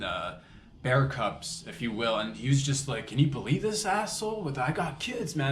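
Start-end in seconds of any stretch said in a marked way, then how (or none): 3.1-5.5 mock exasperated complaint; retold outrage. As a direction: act out a protective father's disbelief. Acts out fuming papa bear.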